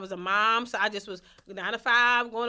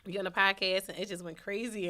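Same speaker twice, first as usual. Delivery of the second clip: ordinary talk, nothing marked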